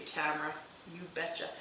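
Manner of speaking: normal talking voice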